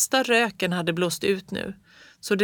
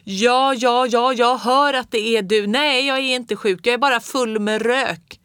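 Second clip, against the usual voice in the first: loud, high annoyed shout; shouting into phone; 5 semitones higher, 6 dB louder than usual. A narrator's reading. Father shouting into the telephone, loud and high. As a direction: yell down the phone line. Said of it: "sen skrika i luren".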